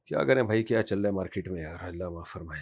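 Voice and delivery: low, soft aside to himself; real under-the-breath sigh of a remark; quiet aside. Real, not acted. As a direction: drop right down, as if thinking aloud. Aside to himself between questions.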